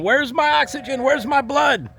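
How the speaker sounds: high, hammed-up mock holler from the brain; cartoon brain hollering for blood, high and insistent. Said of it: "the brain's up there hollering".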